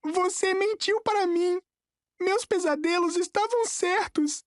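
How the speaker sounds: put-on high, strained boy's voice; voice breaking. A narrator's reading. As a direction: accuse in a high voice on the verge of breaking. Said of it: "Sua voz falhou".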